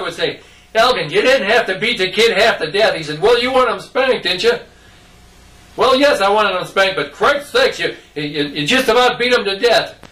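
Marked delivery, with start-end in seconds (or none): 0.7-2.9 higher-pitched retold reproach; mock scolding. Mother to dad after the spanking, mildly scolding. 3.2-4.5 higher-pitched retold comeback; defensive retort. Dad answering mom about the spanking, mock defensive. Father arguing back with mother. 5.8-10.0 high, worked-up retold objection; exasperated protest. Mother arguing with dad over the spanking, exasperated.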